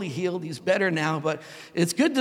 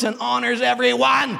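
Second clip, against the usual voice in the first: ordinary talk, nothing marked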